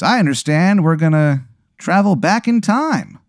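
Jay Crow voice. Acted. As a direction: ham up the pirate driver, breaking into laughs. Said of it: "he goes".

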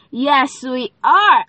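ordinary talk

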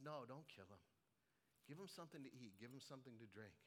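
low, soft, merciful reply; gentle mercy. Acted. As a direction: answer the eager king quietly and kindly. Elisha answers with mercy.

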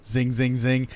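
soft mouth sound effect of arrows flying; zing like arrows whizzing past. Retold or imitated. Describing the arrow fight, imitating arrow zings.

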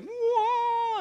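high, loud gasp of amazement at her entrance; a crowd struck by her beauty; awed whoa. Retold or imitated. Preacher voicing the ballroom crowd as Cinderella enters, with a high, loud awed whoa.

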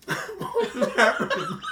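high, slow, strained by spicy burn; gasping out the words through heat; mouth burning from spicy food. Real, not acted. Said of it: "My eyes are watering".

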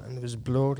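usual speaking voice

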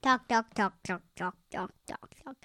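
chanted repetition fading away; mock chatter. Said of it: "and talk and talk and talk".

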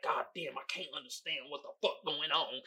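imitating someone mad stressed; stressed-out smoker. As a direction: grumble quietly like you're overwhelmed. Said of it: "you know they mad stressing".

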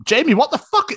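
high, loud mock outrage; exasperated body talking. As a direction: berate him as his own tired body. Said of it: "So your body's going".

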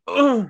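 high, loud groan of impact; mock pain grunt. Spider-Man hit like an Italian footballer, hammed-up grunt. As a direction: imitate a dramatic fall with one grunt. Mimes footballer hitting the deck.

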